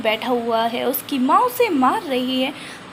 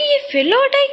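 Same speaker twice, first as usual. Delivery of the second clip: high, louder burst of disbelief; surprised; 12 semitones higher, 6 dB louder than usual. Retold or imitated. Senju shocked that Prachi came back for him, surprised exclamation. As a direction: gasp it out, amazed she returned.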